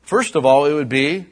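loud, high preaching to the congregation; full-force preaching. Acted. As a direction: preach it out, building with fire.

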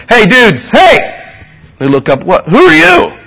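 high, loud call across the water; mock hail to the fishermen. Jesus calling out to the fishermen, loud and high.

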